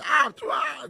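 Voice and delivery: sharp, high, loud cry of disgust at the bitter taste; disgusted exclamation. Retold or imitated. Boy recalling his first taste of alcohol, high disgusted yelp. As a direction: spit it out, high and loud, like the drink burns.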